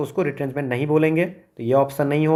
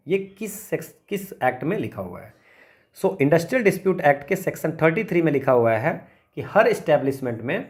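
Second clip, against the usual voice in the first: usual speaking voice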